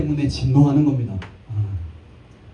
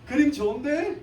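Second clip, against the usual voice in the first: leering, higher street-thug tease with a laugh; mock thug ogling a couple; thug's catcall; 7 semitones higher, slower than usual. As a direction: play the cheesy hoodlum line. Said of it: "늘 하는 대사죠".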